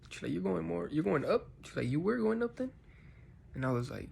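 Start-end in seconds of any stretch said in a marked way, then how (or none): 0.3-1.5 high confused question, rising at the end; imitating someone puzzled, pitch lifting; retold bewildered question. 1.9-2.7 very high, puzzled rising question; retold squeaky-high confused question.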